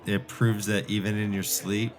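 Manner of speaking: ordinary talk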